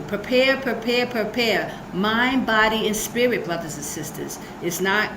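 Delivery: usual speaking voice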